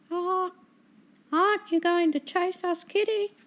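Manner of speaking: high, mocking gull voice; teasing seagull. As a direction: taunt the seasick cat sweetly. Seagull taunting the cat.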